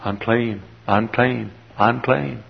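put-on soft, subdued child's call; little boy's cry. Leper's little son warns others, crying out softly. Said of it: "The little boy cries".

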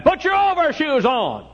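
high, loud, drawn-out holler; hollering mother. Retold or imitated. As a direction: call out a mother's order, slow and piercingly high. Mother's order voiced in story.

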